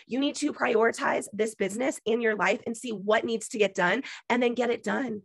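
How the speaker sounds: ordinary talk, nothing marked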